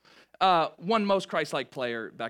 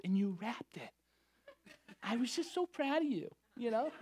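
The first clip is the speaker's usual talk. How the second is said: lightly voiced proud mom; a hint of a proud mother; imitating mom; 8 semitones higher, 7 dB quieter than usual. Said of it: "she goes".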